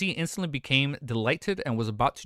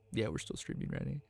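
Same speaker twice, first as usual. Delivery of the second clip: whispered aside; 12 dB quieter than usual. Real, not acted. Aside to chat.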